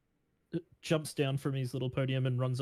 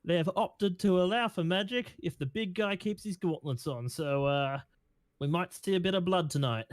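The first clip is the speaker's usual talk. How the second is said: raised, crowd-hyping announcer voice; fighting game announcer; 6 semitones higher than usual. Acted. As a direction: announce it to the whole arena. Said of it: "runs back over and goes".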